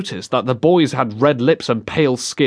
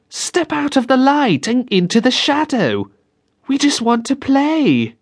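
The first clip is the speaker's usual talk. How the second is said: put-on eerie girl's voice; 12 semitones higher than usual. A narrator's reading. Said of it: "it was a girl's voice".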